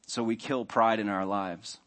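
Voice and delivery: normal talking voice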